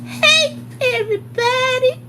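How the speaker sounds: very high, stretched sing-song greeting, rising at the end; imitating Lucretia again. Teacher greets the whole room, very high and stretched. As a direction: coo the hello across the room. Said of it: "That's how she used to walk in the room".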